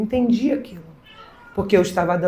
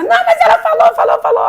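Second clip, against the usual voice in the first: insistent student; 6 dB louder than usual. Retold or imitated.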